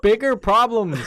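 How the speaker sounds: very high, loud imitation; mock exasperated shout. Doctor retorting to girlfriend about priorities, mock exasperated shout. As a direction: yell it as an overblown comeback.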